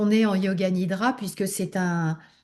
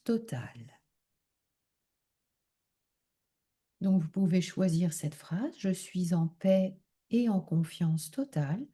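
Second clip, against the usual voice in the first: calm relaxation leading, unhurried and softened; guided meditation; 3 semitones lower, 5 dB quieter than usual. Acted. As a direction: guide them gently into deep rest, phrase by phrase. Leads a yoga nidra relaxation.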